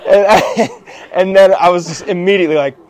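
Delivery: usual speaking voice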